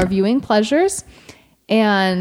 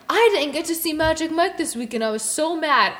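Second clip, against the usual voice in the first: higher-pitched imitation of a whining complainer; mock complaint; 6 semitones higher than usual. Retelling a coworker's complaint about missing Magic Mike, mock complaint. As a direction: put on a coworker's petty grievance, pitched up.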